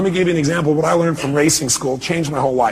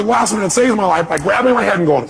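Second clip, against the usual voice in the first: ordinary delivery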